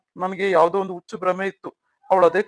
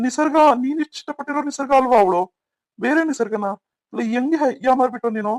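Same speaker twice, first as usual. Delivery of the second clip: higher-pitched, astonished questioning; shocked disbelief; 4 semitones higher than usual. Acted. Older brother learning the bride is someone else, astonished. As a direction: fire off questions in raised, incredulous pitch.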